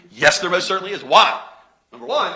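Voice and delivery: ordinary talk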